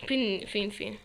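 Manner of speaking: ordinary talk, nothing marked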